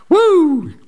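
high, loud amused whoop; whooping cry. Real, not acted. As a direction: let out a delighted whoop. Exclamation after wife's defiance.

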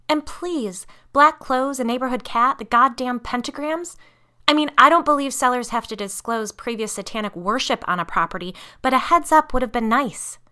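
angry outburst. A narrator's reading. Mother vents about concealed history.

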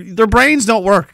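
normal talking voice

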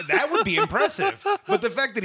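amused, scoffing laugh; laughing mockery. Real, not acted. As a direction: laugh through the sarcasm. Mocking the beer bit.

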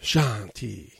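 a calm, drawn-out "Shanti" in a lightly voiced wrestler impression; Jinder Mahal. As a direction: intone "Shanti" peacefully, as if meditating. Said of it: "as Jinder Mahal says".

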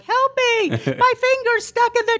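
high, far-off frantic cry for help; panicked plea. Retold or imitated. Trapped wife pleading with strangers on the train. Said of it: "saying to all the people around me".

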